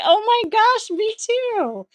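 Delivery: high, loud burst of delight; excited squeal. Real, not acted. To a tipsy chatter, squealing excited agreement. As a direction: gush it out, thrilled to relate. Chat reader also forgot Thursday.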